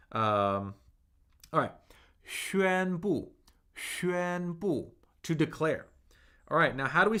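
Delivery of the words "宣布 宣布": high level 宣 into falling 布; tone demonstration. Shown as a demonstration. Teacher returning to "to declare", 宣布 in careful first-fourth tones. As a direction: keep 宣 level and high, let 布 fall. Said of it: "First tone, fourth tone".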